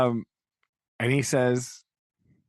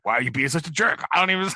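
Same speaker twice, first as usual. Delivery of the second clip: louder, rising, hammed-up outrage; mock indignant retort; 8 semitones higher, 5 dB louder, much faster than usual. Biddy snapping at Pip for being a jerk, mock-indignant and loud. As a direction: fire it back at him, faux-offended.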